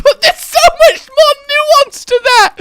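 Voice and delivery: very high, loud laughing protest; laughing wail. Real, not acted. Streamer mocking own impression, wailing with laughter. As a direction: squeal it out while cracking up.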